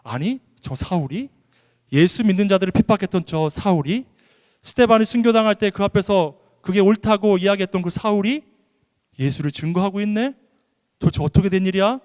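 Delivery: incredulous, emphatic bystander questions; astonished onlookers. Acted. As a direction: act out a crowd's shocked questioning, building in volume. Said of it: "사람들이 다 놀랐어요".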